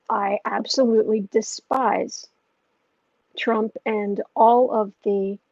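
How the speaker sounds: very slow, each word weighed with disgust; slow, deliberate loathing; slow emphatic contempt. Real, not acted. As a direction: drag it out, leaning on every word. Venting political contempt.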